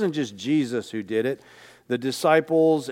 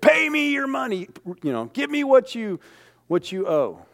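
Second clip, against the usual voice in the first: much higher, pushy demand for the money; demanding debtor; 9 semitones higher than usual. Retold or imitated. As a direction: squeeze the friend for his ten bucks. Forgiven man demanding ten bucks.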